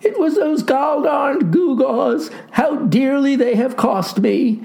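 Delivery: put-on high, anguished boy's lament; drawn-out cry of regret; wailing. A narrator's reading. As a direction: wail with rueful melodrama. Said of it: "wailed he".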